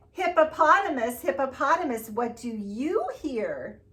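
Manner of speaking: sing-song storybook read; reading to children. Acted. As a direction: read it out to the little ones. Reads picture book aloud.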